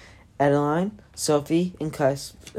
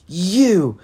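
angry shout; 5 semitones higher, 9 dB louder than usual. Acted. Vika storms in accusing.

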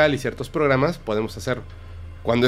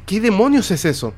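a mock startled cry, pitched up and louder; spooked astronaut; 7 semitones higher, 4 dB louder than usual. Astronaut on the Moon to Houston, mock spooked. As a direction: act rattled by what he sees. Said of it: "ya se está como, sacando de onda".

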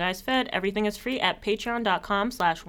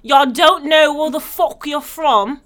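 mocking diaspora critic; 5 semitones higher, 8 dB louder, slower than usual. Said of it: "They be like".

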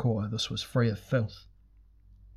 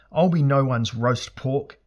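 loud, raised-pitch retort; desperate humorous shout; 4 semitones higher, 7 dB louder than usual. A narrator's reading. Elric shouts back at the pursuing god, desperate humour. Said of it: "Elric shouted back in desperate humour".

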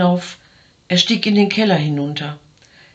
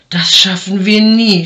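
louder, puffing despair; panting; 5 dB louder than usual. A narrator's reading. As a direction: huff it out, exhausted. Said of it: "schnaufte der Mann". (schnaufend und verzweifelt)

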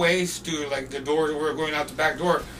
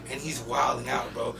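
ordinary delivery

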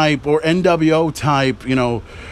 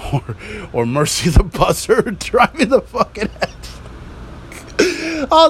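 laughing while talking; 6 semitones higher than usual. Real, not acted. Laughing at own joke.

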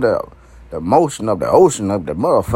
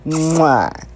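kiss sound; 5 semitones higher than usual. Retold or imitated. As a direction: blow a big kiss. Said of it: "I'm like".

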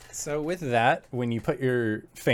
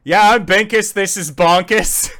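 loud, high hammed-up call; loud echoing call; 6 semitones higher, 11 dB louder than usual. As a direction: shout it so it echoes. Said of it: "you just hear Clout go".

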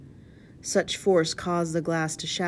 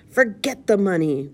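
loud, raised, impatient dismissal; spat retort; 5 semitones higher, 6 dB louder than usual. A narrator's reading. Blind leader dismisses the money, spat out angrily. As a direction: spit the words out at your men. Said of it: "Pugh spat".

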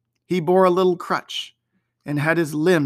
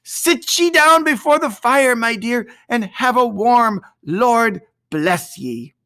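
fond mother; 7 semitones higher, 4 dB louder than usual. A narrator's reading. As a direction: settle her by the fire lovingly. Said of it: "said Missus Cratchit".

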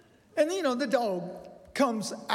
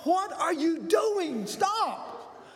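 high-pitched mock yell of a frightened kid; retold scream; 7 semitones higher, slower than usual. Granddaughter yelling at Pop chasing the dog, high mock scream. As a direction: pitch way up, calling a grandpa to stop. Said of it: "Lila screams at me".

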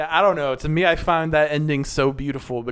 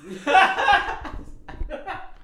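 laughing. Real, not acted. Joke about Boss Baby two.

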